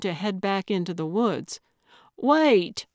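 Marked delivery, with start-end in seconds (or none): 2.2-2.9 high, loud call to stop; sudden raised-voice exclamation; urgent cry. A narrator's reading. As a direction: cry out to halt someone leaving. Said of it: "Gertrude cried".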